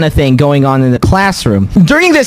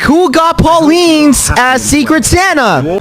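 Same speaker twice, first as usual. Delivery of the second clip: high, slow announcement to a room; calling out; 10 semitones higher, slower than usual. Retold or imitated. Classroom Secret Santa handout, calling out for the giver. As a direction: call it out to the class, high and drawn out.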